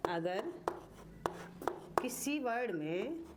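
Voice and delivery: measured phrases with long pauses and repeats. Shown as a demonstration. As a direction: dictate it bit by bit for copying. Dictates a rule while writing.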